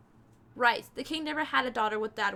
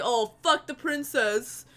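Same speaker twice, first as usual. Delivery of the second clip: mock quote; 6 dB louder, slower than usual. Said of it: "that was just like".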